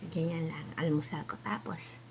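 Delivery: whispered aside. Real, not acted. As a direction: whisper it to yourself.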